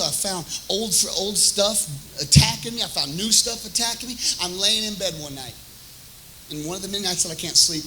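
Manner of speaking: ordinary delivery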